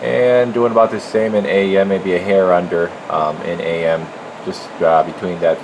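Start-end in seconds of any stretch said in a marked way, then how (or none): none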